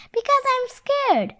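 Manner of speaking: put-on high squeaky scared little boy; small, high, frightened character voice. A narrator's reading. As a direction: squeak it like a timid child. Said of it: "a squeaky voice to the big giant bear".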